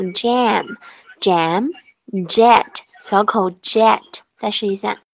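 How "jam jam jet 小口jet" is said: very slow jam open-mouthed, jet small-mouthed; slow careful jam and jet; jam and jet demo. Shown as a demonstration. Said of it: "大口".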